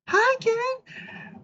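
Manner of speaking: very high squeaky doll voice; Barbie squeak. Retold or imitated. Answering Ken as Barbie, very high squeaky doll. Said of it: "how Ken opens up".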